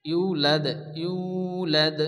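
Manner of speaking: ইউ ল্যাদ with long "oo" and bounced d; slight qalqalah. Shown as a demonstration. Teacher demonstrating qalqalah on dal, bounced d in ইউ ল্যাদ. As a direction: echo the d ending ল্যাদ. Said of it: "একটু কলকলা করে পড়তে হবে".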